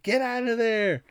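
high, strained mock call; urgent cornerman. Duke calling to Rocky from the corner, high mock urgency.

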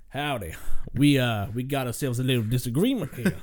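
mild southern cowboy accent, lightly put on; a light hint of a southern gunslinger accent. Gamer playing a southern shotgun cowboy, lightly put-on southern accent. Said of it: "Just with his own accent".

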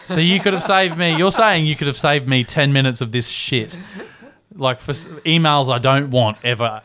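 acted-out indignant dad tirade; dad rage. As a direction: vent the imagined complaint in mock outrage. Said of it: "that's dad rage".

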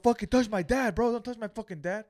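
angry warning. Retold or imitated. Retelling son warning off the worker, angry shout. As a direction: imitate a son warning someone off.

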